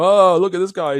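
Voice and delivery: high, loud, sneering scoff; mocking pushback. Someone mocking a man who objected to a joke, sneering. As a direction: brush him off as oversensitive. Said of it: "a little bit of pushback".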